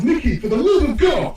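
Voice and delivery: high mock-British exasperation; hammed-up British appeal; imitated British pleading. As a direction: copy a friend's British plea. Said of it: "just as Nino says".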